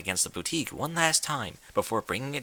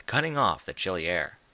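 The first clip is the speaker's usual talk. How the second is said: ordinary delivery, nothing marked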